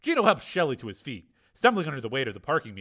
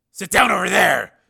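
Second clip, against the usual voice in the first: loud, raised command; barked order; 8 semitones higher, 14 dB louder than usual. Acted. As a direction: order a captive about. Ordering a captive around.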